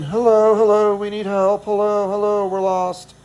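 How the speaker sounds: a hint of helpless tourists calling out; lost tourists asking strangers for help; dumb lost Americans. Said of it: "like a bunch of dumb Americans".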